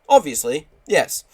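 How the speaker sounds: loud, high, hammed-up reply; emphatic retort. Acted retort in complaint reply.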